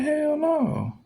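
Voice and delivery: high, loud outraged "Hell no"; a high indignant refusal. Retold or imitated. Retold refusal to the accusation.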